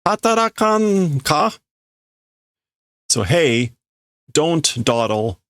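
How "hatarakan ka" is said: raised, high-pitched scolding of a lazy leader; angry scolding. Acted. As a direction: bark it at him from high up.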